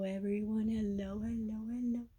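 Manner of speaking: ordinary talk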